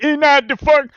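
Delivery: high, loud hammed-up squeal of his own retort; mock himself. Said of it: "And I would be like".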